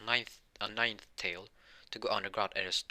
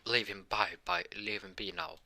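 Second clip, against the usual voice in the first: ordinary talk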